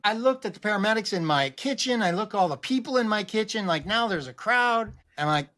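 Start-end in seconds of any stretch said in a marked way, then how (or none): none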